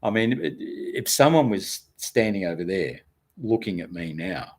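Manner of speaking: ordinary talk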